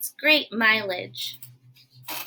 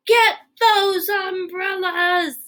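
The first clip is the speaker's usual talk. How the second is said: slow dramatic order; 7 semitones higher, slower than usual. Acted. Gerald orders the umbrellas.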